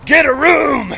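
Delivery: high heckling shout; heckling yell. Retold or imitated. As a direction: yell it across the room. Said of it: "Somebody yells".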